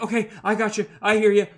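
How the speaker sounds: a sidekick taking orders fast; eager Reef. Acted. Reef answering Red R's medical orders, eager. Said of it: "Reef is just, like".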